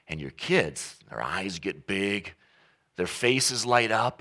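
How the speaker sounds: ordinary talk, nothing marked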